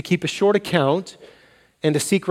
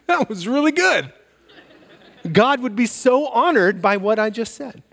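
sarcastic self-praise; 5 semitones higher than usual. Pretend. Mock self-congratulation, sarcastic.

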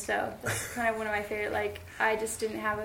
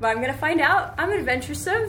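higher, louder playful boast; mock bravado; 6 semitones higher, 10 dB louder than usual. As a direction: puff yourself up jokingly. Mock brave self-talk.

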